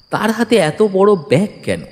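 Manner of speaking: ordinary talk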